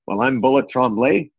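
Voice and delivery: ordinary delivery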